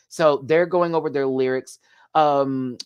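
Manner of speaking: ordinary talk, nothing marked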